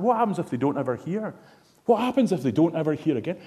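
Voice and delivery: frantic retold plea to turn back; worked up pleading. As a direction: beg him to go back, rising each question. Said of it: "I was worked up then".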